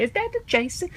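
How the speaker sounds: high, silly voice of a clueless viewer; mock confused moviegoer. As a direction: act out a confused audience member. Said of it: "Everybody say".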